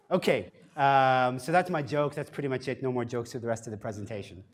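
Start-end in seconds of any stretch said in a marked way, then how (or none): none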